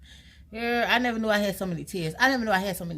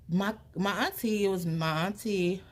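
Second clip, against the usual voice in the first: ordinary talk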